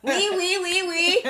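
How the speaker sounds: mock French chirping; playful, hammed-up French accent. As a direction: tease with a silly French accent. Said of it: "attempt to do the French accent".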